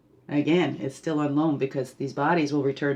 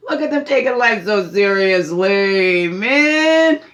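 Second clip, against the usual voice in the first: laughing spirit; 5 dB louder than usual. Acted. Healer voices spirit laughing at humans fighting, amused and teasing. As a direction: start on a laugh, then marvel loudly at them. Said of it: "there might be spirit laughing, going".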